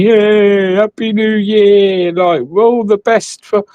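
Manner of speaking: high, slow sing-song cheer; overjoyed fake party greeting; mock cheer. Mock cheerful new year wishes.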